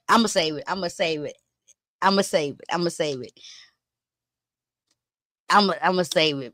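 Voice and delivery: holding back the gossip in a low hush; teasing hush. Real, not acted. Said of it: "I'm trying to save that for tomorrow".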